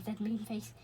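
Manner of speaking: ordinary delivery, nothing marked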